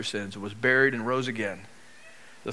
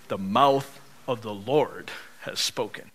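slow and lower, phrase by phrase; solemn emphasis; 5 semitones lower, slower than usual. Acted. Preacher answering whose voice it is, slow solemn emphasis. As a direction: spell out the answer with weighty pauses.

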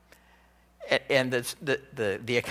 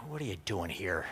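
contempt; 7 semitones lower, 12 dB quieter than usual. Retold or imitated. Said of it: "There's a contempt for his brother".

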